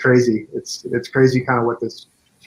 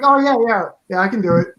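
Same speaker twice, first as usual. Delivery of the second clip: high, quick-to-agree mimicked reply; eager agreement; 9 semitones higher than usual. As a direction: imitate an obliging yes, pitched up. Acting out the actor's eager reply.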